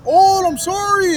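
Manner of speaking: high, slow, loud fake-sorry voice; a hammed-up gunman saying sorry; mock apology. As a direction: drag it out like an insincere apology. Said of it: "And he goes".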